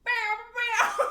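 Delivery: high vocal sound effect; dramatic sting sound. Acted.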